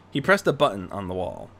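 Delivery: plain reading voice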